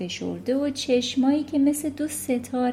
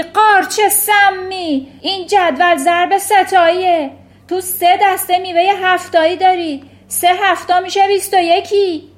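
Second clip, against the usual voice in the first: shrill, much higher and louder abusive tirade; ranting headmistress; 8 semitones higher, 12 dB louder than usual. Acted. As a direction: scream insults at the terrified boy. Headmistress berating a pupil.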